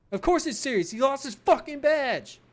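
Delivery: loud high sneering yell; mocking shout. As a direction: shout it to ridicule him. Colleague mocking loudly.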